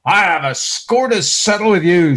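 loud, vengeful threat; mock vengeful threat. Preacher mocking a vengeful Lord speaking to Saul. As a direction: growl out the grudge in a hammed-up threat. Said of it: "saith the Lord".

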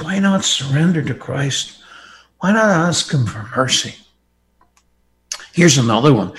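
ordinary delivery

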